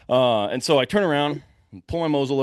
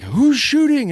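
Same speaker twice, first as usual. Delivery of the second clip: high, loud call across the woods; shouted question from a distance; retold yell; 8 dB louder, slower than usual. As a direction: holler it to someone far off. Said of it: "he's yelling".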